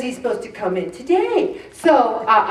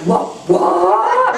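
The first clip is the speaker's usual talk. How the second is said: shocked disbelief; 10 semitones higher than usual. Retold or imitated. Hearing the son won't come until Saturday, stunned. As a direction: hit it with laughing disbelief. Shocked at son's change of plans.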